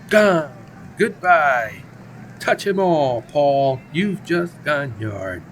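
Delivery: loud, soaring announcer home run call. Acted. Announcer calling a homer out to the fans, loud and thrilled. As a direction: belt it out like the ball just left the park.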